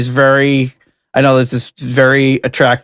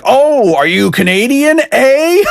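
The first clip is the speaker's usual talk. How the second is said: mock Canadian; 11 semitones higher, slower than usual. American teasing a Canadian, exaggerated mock Canadian accent. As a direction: tease in a cartoon Canadian accent. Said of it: "somebody's Canadian, they're like".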